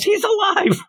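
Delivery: very high-pitched mock shriek; hammed-up cry of shock, no real volume; Midoriya's yell. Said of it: "Then Midoriya yells".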